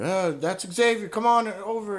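like a performer inviting someone up from the crowd; mock show host beckoning from across the room; calling someone over. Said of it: "I hear".

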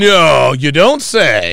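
slow, drawn-out sarcasm; sarcastic. Real, not acted. Host mocking her boast, slow sarcastic drawl. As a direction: drag it out mockingly. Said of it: "sarcastic reply".

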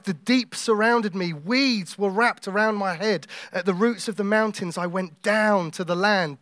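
weighty pulpit reading, stretching the words; dramatic scripture reading. Real, not acted. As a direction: read it out slowly and forcefully. Dramatic scripture reading while preaching.

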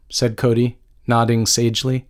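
ordinary delivery, nothing marked

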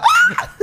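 very high, loud mock shriek; inner scream. Victim showing how he was inside, mock panicked scream. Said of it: "Por dentro estaba".

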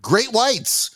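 excited warning. Retold or imitated. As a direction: announce the danger with wide-eyed thrill. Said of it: "And I'm like".

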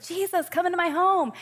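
high, loud eager invitation, voicing Martha; excited welcome. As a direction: gush like a thrilled host greeting Jesus. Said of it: "she was so excited, like".

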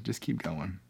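hushed, sincere pep talk; gentle encouragement. Real, not acted. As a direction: say it quietly, just for him. Gently repeats encouragement to bereaved viewer.

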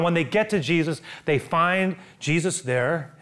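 normal talking voice

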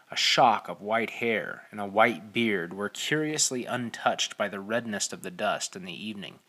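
ordinary delivery

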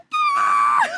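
high shriek into a snorkel; replaying the shark scream; reenacted scream. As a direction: let out a short piercing scream. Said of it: "I was just like".

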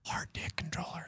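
breathy secret whisper, very soft. Real, not acted. Host teasing the post show secret, soft whisper.

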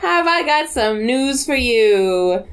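put-on slow, laughing creepy child; creepy kid voice. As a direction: gleefully announce doom, slowly. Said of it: "she's like".

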